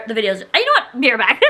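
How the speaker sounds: usual speaking voice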